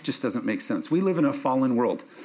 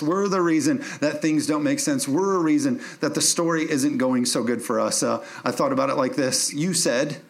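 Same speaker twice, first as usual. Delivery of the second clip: normal talking voice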